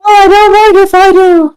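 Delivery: put-on very high old-lady voice; grandma voice. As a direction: squeak it out like a sweet granny. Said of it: "they wanna hear you do this as well".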